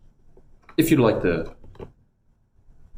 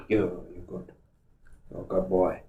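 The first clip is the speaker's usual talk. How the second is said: softer, hushed praise to the dog; soft pet talk; 12 dB quieter than usual. Real, not acted. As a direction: murmur praise to calm the pup. Soothing the dog.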